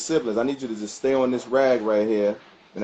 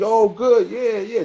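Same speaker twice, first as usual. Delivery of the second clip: excited cheering; 9 semitones higher, 3 dB louder, slower than usual. Retold or imitated. Crowd cheering on a handler.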